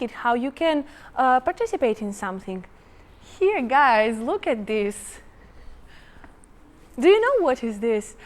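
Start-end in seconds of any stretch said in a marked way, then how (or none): none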